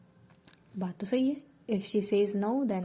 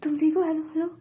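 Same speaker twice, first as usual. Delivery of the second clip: higher, urgent and frantic; panicked; 6 semitones higher than usual. Acted. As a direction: panic over a friend who is collapsing. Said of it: "panic करने लगती है".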